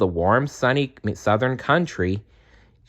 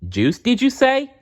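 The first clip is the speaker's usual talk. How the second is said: high, eager question; squeaky excited gnome; excited cry; 3 dB louder than usual. A narrator's reading. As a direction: jump up in eager surprise. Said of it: "cried Larch".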